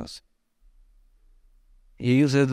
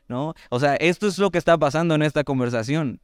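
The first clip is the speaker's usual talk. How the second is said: ordinary talk, nothing marked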